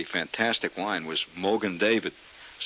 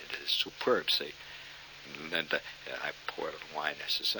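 normal talking voice

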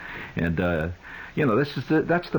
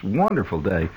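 usual speaking voice

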